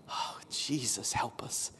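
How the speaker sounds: soft unvoiced plea; hushed prayer; whispered prayer. Real, not acted. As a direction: breathe it as a prayer.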